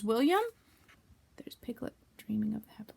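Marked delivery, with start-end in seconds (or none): 1.4-2.9 unvoiced aside whisper. Real, not acted. Teacher whispering to the class about Piglet.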